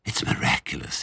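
breathless, low awed whisper; winded by a fiery gulp. A narrator's reading. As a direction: whisper it while fighting for breath. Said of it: "he whispered".

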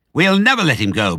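high, loud vow; emphatic declaration. A narrator's reading. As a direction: declare it defiantly to the others. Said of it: "Bunce declared".

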